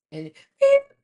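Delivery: high loud reverse beep; playful truck beep sound; imitated beep.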